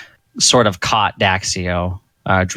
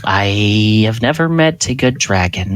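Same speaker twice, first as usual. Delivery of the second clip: usual speaking voice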